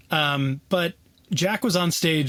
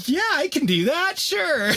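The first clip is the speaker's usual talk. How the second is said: much higher, laughing fake confidence; faked confidence; 12 semitones higher than usual. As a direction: pretend to sound sure while panicking inside. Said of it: "trying to look all confident".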